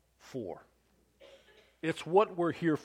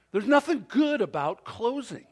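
ordinary talk, nothing marked

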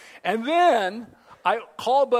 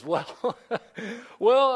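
imitating a man chuckling before he answers; retold chuckle; 4 semitones higher, 3 dB louder than usual. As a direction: chuckle between the words, as if amused by the question. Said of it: "and then a chuckle".

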